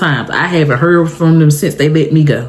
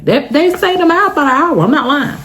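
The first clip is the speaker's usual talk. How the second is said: indignant exclaim; 11 semitones higher than usual. Real, not acted. Indignant about being framed.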